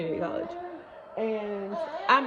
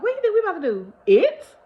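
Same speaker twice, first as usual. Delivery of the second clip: high, loud scoffing question; outraged high question; mock indignant; 11 semitones higher, 8 dB louder than usual. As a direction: scoff at the very idea. Retold mocking thought.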